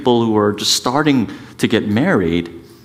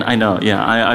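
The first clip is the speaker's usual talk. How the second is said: normal talking voice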